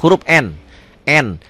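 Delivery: ordinary talk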